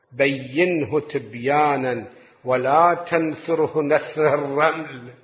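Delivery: slow, deliberate quoting; slow measured reading. Shown as a demonstration. Preacher quoting the hadith slowly. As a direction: lay each word down slowly. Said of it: "بتأني اقرأ".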